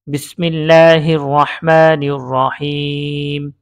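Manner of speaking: slow, drawn-out sacred recitation; Quranic recitation. Acted. Teacher opens the drill with Bismillah, in measured recitation. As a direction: recite it slowly with long held vowels.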